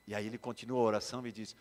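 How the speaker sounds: normal talking voice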